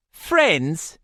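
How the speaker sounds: mocking London accent, laughing scoff. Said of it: "in a London accent and laughed".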